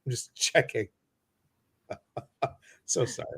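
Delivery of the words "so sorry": ordinary talk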